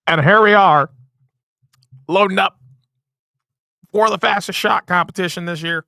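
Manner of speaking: put-on high old-time newsreel announcer; vintage broadcaster, building to a shout; 1950s narrator. Shown as a demonstration. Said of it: "old-time announcing broadcast voices".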